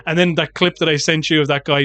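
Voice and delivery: ordinary delivery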